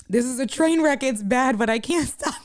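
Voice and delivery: cracking up at the end; real helpless laughter. Real, not acted. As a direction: start amused, then lose it completely. Cracking up at her own mishap.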